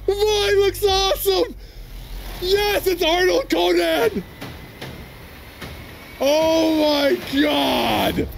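high, loud, thrilled shouting; ecstatic fan yelling. Real, not acted. Fan watching the trailer yells at each reveal, thrilled. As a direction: scream with pure hype at the reveal.

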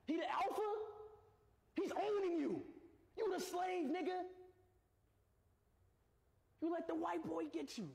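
high, incredulous teasing; high-pitched taunting. Real, not acted. Taunting dog about new alpha.

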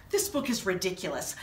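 ordinary delivery

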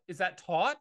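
ordinary delivery

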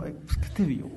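breathy unvoiced whisper of disbelief; whispered wondering. Wondering what his sister saw in him, whispered. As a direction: hush it like a private thought.

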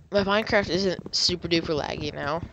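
ordinary delivery

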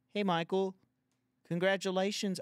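high, loud mock letter-reader voice; put-on fan voice. Reading out a teasing fan email, in a high loud put-on voice. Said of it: "I guess I'm gonna do this voice".

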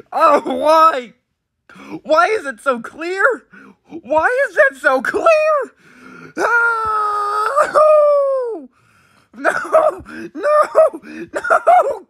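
high wailed protest through laughter; laughing anguish. Real, not acted. Reacting to a lyric that is so clear, laughing anguish. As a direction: wail it in comic despair.

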